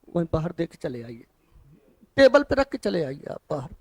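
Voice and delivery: quiet quick aside. Real, not acted. Teacher quietly sends a student outside, a soft hurried aside. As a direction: murmur an instruction to a student away from the class.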